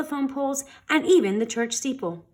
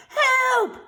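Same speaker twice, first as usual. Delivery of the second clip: much higher frightened plea; pleading cry; 4 dB louder than usual. A narrator's reading. The grown-ups to the kids, pleading high. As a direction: cry for help as the monster grabs you. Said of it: "they pleaded".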